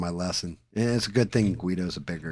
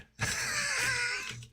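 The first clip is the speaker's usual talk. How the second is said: high laugh; 6 dB quieter than usual. Real, not acted. Laughing at own story.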